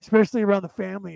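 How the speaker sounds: ordinary talk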